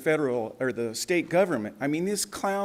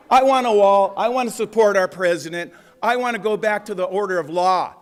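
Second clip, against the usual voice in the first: loud, raised-pitch rally cry; fervent, raised-voice public plea; impassioned demand; 3 semitones higher, 6 dB louder than usual. Real, not acted. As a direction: declare it forcefully to the room. Impassioned closing demand at council.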